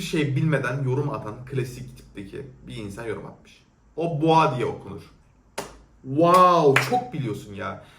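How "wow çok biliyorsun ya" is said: sarcastic. Acted. Mocking an ignorant commenter.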